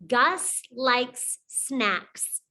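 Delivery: very slow model sentence; slow, clear sentence. Shown as a demonstration. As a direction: stretch each word for the children. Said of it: "And the last sound is".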